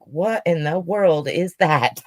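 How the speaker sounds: normal talking voice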